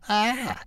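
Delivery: very high frightened yelp; startled yelp. A narrator's reading. Ralph sees the cat at the window, yelping. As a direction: let out a sudden high-pitched yelp. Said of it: "he yelped".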